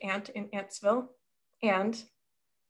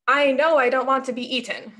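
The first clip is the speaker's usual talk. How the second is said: raised, louder, indignant refusal; angry ladybug; 5 semitones higher, 6 dB louder than usual. A narrator's reading. Ladybug refusing to be eaten by the spider, angry and louder. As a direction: push back hard, unwilling to be prey. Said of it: "said the angry ladybug".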